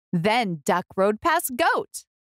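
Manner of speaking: ordinary delivery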